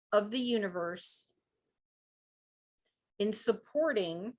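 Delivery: slow, phrase by phrase with long pauses; very slow reading. Shown as a demonstration. Healer leads listeners in a repeat-after-me affirmation, very slowly. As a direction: read it out slowly and deliberately, leaving gaps to repeat. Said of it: "I'll read as slowly as I can".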